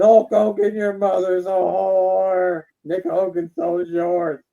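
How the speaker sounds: loud gruff wrestler promo yell, slow on the insult; Terry Funk quote. Retold or imitated. Guest retelling Terry Funk's insult to Hulk, slow shouted promo. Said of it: "He said".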